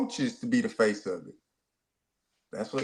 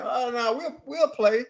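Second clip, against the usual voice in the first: mock agreement; 8 semitones higher than usual. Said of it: "Fran Brown saying".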